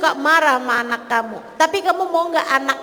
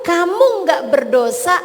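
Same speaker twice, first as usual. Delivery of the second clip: normal talking voice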